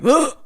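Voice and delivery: wordless "ugh" of a hulking man; imitated grunt. Voices hulking man at door.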